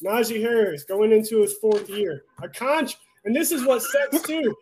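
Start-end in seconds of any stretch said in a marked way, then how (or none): 3.3-4.5 fed-up fan sounding off; heated rant. Real, not acted. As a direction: vent at the coach, voice climbing. Ranting about the coach.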